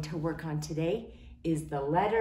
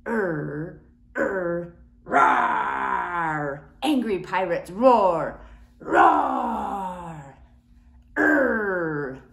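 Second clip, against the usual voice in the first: low loud growling roar; angry pirate roar; 3 semitones lower, 5 dB louder, much slower than usual. Pretend.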